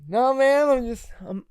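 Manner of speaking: high, flustered stammer; flustered denial. Retold or imitated. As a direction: fumble for an answer. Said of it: "I said".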